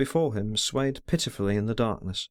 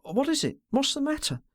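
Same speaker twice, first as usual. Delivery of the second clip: much higher, despairing exclamation; girl exclaiming in despair, high and thin; despairing cry; 13 semitones higher than usual. A narrator's reading. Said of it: "exclaimed with an accent of despair".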